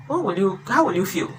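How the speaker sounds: usual speaking voice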